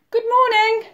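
put-on high, cheery girl's call; sing-out storybook hello. A narrator's reading. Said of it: "she called to the rabbits".

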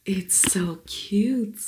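slow breathy whisper. Real, not acted. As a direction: whisper it in awe. Whispered delight.

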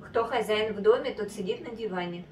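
normal talking voice

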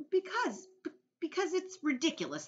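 put-on high, stammering child's protest; flustered boy. A narrator's reading. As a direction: splutter back indignantly.

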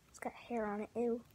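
disgusted whisper. Real, not acted. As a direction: hush it out, grossed out. Disgusted at hairy apple.

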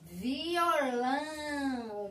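Violão drawn out slowly with a falling end; slow reading aloud. Shown as a demonstration. Teacher reading a word aloud to kids, drawn out. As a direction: stretch the word out for children to read. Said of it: "Vamos ler aqui".

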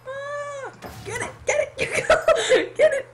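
high, excited yelps with laughter; excited urging. Real, not acted. Player urging a friend to grab the loot, excited. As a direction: urge them on in a high, thrilled voice.

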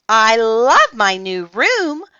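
loud, high, drawn-out gushing; delighted cry. A narrator's reading. Francine delighted with her room, happy cry. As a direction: gush with stretched words. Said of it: "cried Francine".

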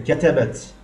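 كتبت ending in -ti; feminine addressee ending. Shown as a demonstration. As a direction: say كتبت as katabti. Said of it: "تاء المخاطبة".

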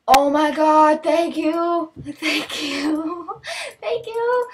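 high, drawn-out gushing; excited thanks. Real, not acted. Streamer thanking viewer for a gift, thrilled squeal.

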